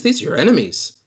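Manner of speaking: high, insistent voice in the head; insistent mock mind-control voice; inner voice persuading. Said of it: "you hear your own voice".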